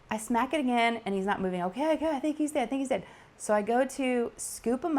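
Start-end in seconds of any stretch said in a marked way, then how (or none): 1.6-3.0 quick high nervous relief; relieved rush. Retold or imitated. Mom hoping the grasshopper is dead, fast nervous relief. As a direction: rush it out, hoping it's over. Thinks bug finally dead.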